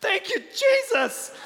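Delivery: very high, loud, slow cry of pain turned praise; mom's yelp. Retold or imitated. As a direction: yelp it high as she stubs her toe. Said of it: "and she goes".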